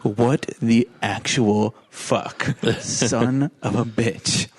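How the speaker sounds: very slow, numb muttered cursing; dazed disbelief. Retold or imitated. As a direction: stare at the floor and drag each word. Dust-covered boy stares at floor.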